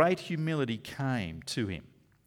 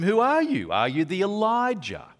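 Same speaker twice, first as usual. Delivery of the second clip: raised, pressing voice of the questioners; loud questioning; 7 semitones higher, 7 dB louder than usual. Acted. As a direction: demand it of him loudly. Priests questioning John.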